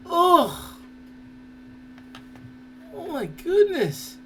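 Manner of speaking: normal talking voice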